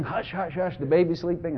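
quick, quiet mock shushing; imitated hushing. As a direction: hush someone so the baby stays asleep. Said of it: "Janet sang".

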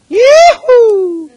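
high, loud rodeo yell; a wild cowboy holler; cowboy whoop. Acted. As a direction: let out a big Texas yeehaw. Texas arrival whoop.

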